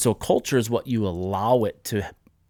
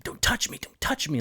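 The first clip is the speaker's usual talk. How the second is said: scared; 6 semitones higher, 4 dB quieter than usual. Retold or imitated. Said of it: "like, scared".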